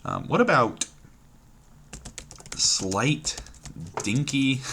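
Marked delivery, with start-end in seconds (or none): none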